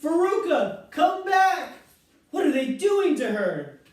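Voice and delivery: very high, loud frantic calling; panicked scream. A narrator's reading. Mother screaming after Veruca, very high and loud. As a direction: shriek after a child being dragged off. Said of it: "screamed Missus Salt".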